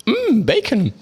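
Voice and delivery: high-pitched savouring food impression; meme woman. Quoting a food meme to friends, high put-on meme voice. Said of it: "kennt ihr die eine?".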